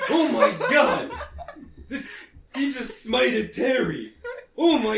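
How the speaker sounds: high, slow panicked wail; terrified, near tears. Acted. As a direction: sob it out in horror. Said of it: "So he's like".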